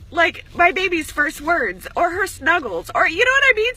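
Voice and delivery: choked up and climbing in pitch; teary. Real, not acted. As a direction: voice rising high as she starts to cry, slowing down. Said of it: "Look at me, I'm fucking crying".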